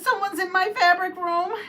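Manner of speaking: high mock-indignant mama bear. Mama Bear discovering the intruder, high indignation. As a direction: sound scandalized.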